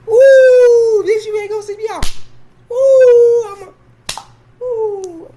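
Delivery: loud, very high drawn-out "woo"; high whoop. Acted. Whooping reaction to scary figure.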